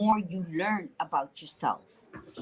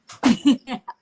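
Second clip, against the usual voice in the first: short burst of laughter; a loud bright laugh at herself; real laugh; 5 semitones higher, 8 dB louder than usual. Real, not acted. Laughing at her own carefree attitude.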